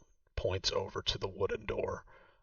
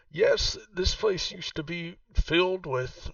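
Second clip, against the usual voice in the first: put-on a raised, slower voice for the old woman Vaku; game master voicing Vaku, noticeably higher; Vaku's voice; 10 semitones higher, 5 dB louder, slower than usual. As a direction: play the proud host showing her old home. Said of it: "And she says".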